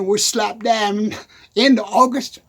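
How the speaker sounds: ordinary talk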